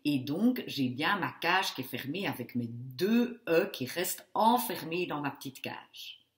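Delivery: ordinary talk, nothing marked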